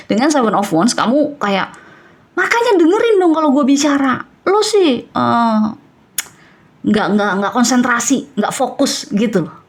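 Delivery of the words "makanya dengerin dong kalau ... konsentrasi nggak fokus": higher-pitched snappy complaint; a hint of an annoyed retort; mock irritated outburst. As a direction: play the listener who is fed up. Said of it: "kamu marah".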